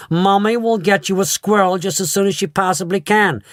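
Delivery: high soothing mother voice; calm a spoiled child. A narrator's reading. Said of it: "Missus Salt said soothingly".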